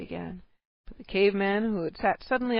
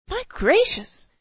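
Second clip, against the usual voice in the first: high, loud sudden gasp of surprise; startled cry; 11 semitones higher, 8 dB louder, slower than usual. A narrator's reading. Cave wife suddenly notices a visitor, loud startled cry. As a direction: caught out in front of a guest. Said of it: "she broke off all of a sudden".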